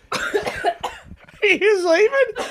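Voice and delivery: laughing. Real, not acted. Laughing at sleepy wife.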